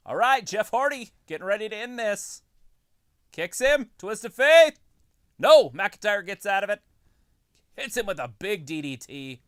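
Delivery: hyped ringside play-by-play; wrestling commentator. Acted. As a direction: call the match loud and excited.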